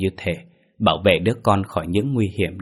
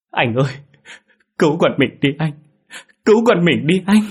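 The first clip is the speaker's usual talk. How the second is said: high, desperate wail rising louder; sobbing plea; 9 semitones higher, 6 dB louder than usual. A narrator's reading. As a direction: beg your husband for help through sobs. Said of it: "Cô ả lên nức nở". (nức nở cầu cứu)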